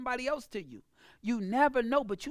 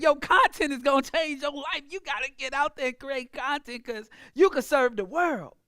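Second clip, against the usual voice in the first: excited and loud, full of belief; fired-up pitch that makes them believe; energetic; 2 semitones higher, 4 dB louder than usual. Shown as a demonstration. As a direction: sell it with real energy. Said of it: "that same energy".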